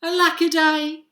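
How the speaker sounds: high, loud theatrical lament; lamenting cry. A narrator's reading. Drip left behind by his family, lamenting cry. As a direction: cry out a storybook woe. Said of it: "And he cried".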